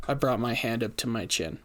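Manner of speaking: ordinary delivery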